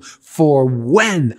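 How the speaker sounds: "when" leaned on and held; stress on when. Shown as a demonstration. Preacher rereading the verse to stress timing, "when" emphasized. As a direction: hit "when" as if underlined. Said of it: "underline the word when".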